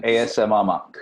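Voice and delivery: "A S M R" run together as ay-ess-em-ar; wrong pronunciation. Shown as a demonstration. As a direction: say "A S M R" as spelled-out letters. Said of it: "that was the wrong way to pronounce it".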